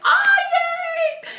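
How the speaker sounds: very high, loud squealing cheer; excited yay. Retold or imitated. Fan cheering after the boy thanks her sister, squealing.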